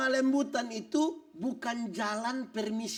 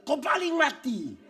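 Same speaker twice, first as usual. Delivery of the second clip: mock angry husband; 5 semitones higher, 5 dB louder than usual. Husband snaps back at wife.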